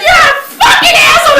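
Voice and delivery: high, loud furious yell; angry mom yell. Retold or imitated. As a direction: yell it at your choking daughter. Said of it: "calling me an asshole".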